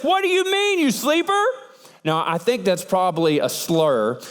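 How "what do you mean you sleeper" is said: captain yelling. Acted. Sea captain yelling down at sleeping Jonah, high shout. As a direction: shout it down into the hold. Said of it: "he yells down to him".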